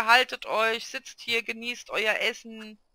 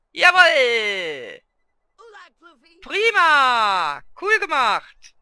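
loud, cheering praise for the dog; calling out loud praise to Mikesh; shouted praise; 4 semitones higher, 9 dB louder, much slower than usual. Pretend. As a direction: cheer the pet on at full volume. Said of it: "schrei doch Mikesh nicht so an".

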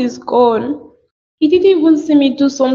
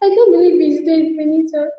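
grieving sobs in the voice; crying; 2 semitones higher than usual. Acted. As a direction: cry through the words in grief. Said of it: "You have to stop crying".

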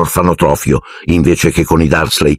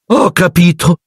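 high, knowing aunt voice; high satisfied aunt; Aunt Marge; 11 semitones higher than usual. A narrator's reading. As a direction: say it high and smug. Marge reacts to Vernon.

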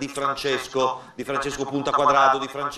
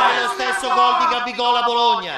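excited shout; 9 semitones higher than usual. Real, not acted.

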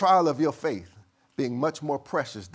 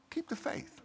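hushed whisper; 12 semitones higher, 11 dB quieter than usual. Acted. Repeated exhortation, whispered.

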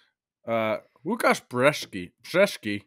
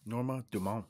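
soft, lightly French name; a hint of put-on French; mock French accent; 11 dB quieter than usual. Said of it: "He went French".

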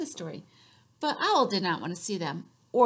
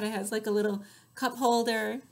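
ordinary delivery

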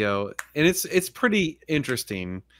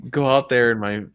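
ordinary delivery

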